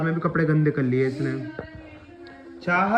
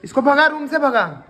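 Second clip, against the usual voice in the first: shouting; 9 semitones higher, 4 dB louder than usual. Real, not acted. Child shouting to chase out.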